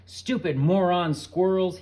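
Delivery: loud, slow furious scream. A narrator's reading. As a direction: scream at the squirrels. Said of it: "he was screaming".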